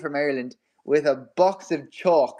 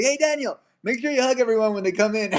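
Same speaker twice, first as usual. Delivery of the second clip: retold excited call; 7 semitones higher, faster than usual.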